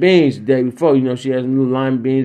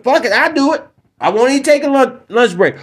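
pitched way up, fired-up volunteering; worked-up rant; 9 semitones higher than usual. Real, not acted. Comic ranting at gun owners, fired up and high. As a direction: shout it high like you can't wait.

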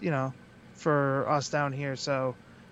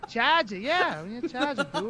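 higher-pitched mock Boston accent; gross Boston accent; 6 semitones higher than usual. Wrestler playing the locker-room guy confirming, mock Boston accent. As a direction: imitate a Bostonian dropping the r's in "charger". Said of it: "gross Boston accent".

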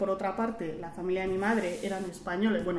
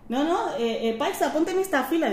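higher, insistent correcting voice; raised-pitch mock instruction; imitated queue official; 7 semitones higher, 3 dB louder than usual. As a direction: mimic someone directing a traveller to a line. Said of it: "te dicen".

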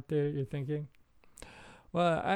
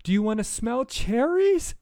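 high and slow; hammed-up eager child; eager little kid; 7 semitones higher, 3 dB louder than usual. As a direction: sound like a thrilled five-year-old. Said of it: "And I was like".

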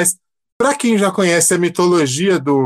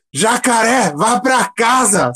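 high, very slow drawl; slow drawn-out sendoff; 6 semitones higher, much slower than usual. Pretend. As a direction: stretch each word out slowly. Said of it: "A minha vontade, em vez de falar".

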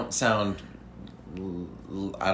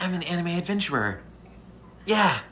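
eager anime hero, a bit higher; anime adventurer voice; 7 semitones higher than usual. Acted. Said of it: "I'm getting in character".

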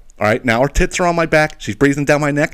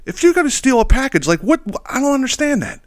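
higher, bewildered exasperation; a mock lecture to a package thief, much higher; exasperated; 7 semitones higher than usual. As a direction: rant at an imaginary thief in disbelief. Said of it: "I'm sitting here saying to myself".

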